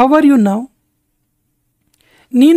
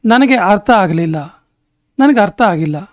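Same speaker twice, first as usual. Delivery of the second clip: ordinary talk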